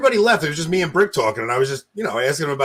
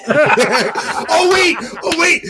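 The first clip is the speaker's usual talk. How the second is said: laughing; 8 semitones higher, 4 dB louder, much slower than usual. Real, not acted. Laughing realization.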